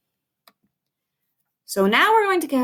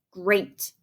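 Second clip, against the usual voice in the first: ordinary talk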